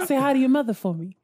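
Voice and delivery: put-on fast, laughing Boston accent; quick Boston accent through laughter. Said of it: "And that's in a Boston accent".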